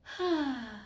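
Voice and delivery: soft, weary exhale; downhearted sigh. Retold or imitated. Woman showing her whole mood back then, weary sigh. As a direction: sigh as if worn down. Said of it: "that was my whole mood".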